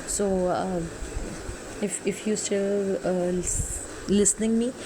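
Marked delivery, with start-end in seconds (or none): none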